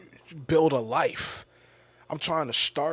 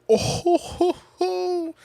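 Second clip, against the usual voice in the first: high, loud drawn-out "ohhh ho ho"; imitating a high, loud hooting reaction; imitated "oh ho ho"; 8 dB louder, slower than usual. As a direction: hoot it out in mock reaction. Imitating friend's reaction to the insult.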